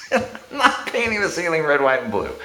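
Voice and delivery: high-pitched mock protest through laughter; laughing refusal. Landlord refusing his friend's paint idea, laughing. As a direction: refuse while cracking up, dropping at the end.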